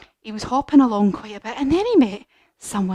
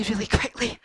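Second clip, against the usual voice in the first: ordinary talk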